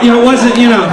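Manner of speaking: ordinary talk, nothing marked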